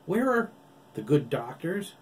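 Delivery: loud, high sing-song; playful loud query to Oliver; brash call. Acted. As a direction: toss the question out teasing. Jake arrives cocky.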